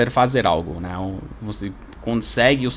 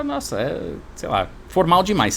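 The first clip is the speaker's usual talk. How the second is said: imagined reaction; 5 semitones higher than usual. Pretend.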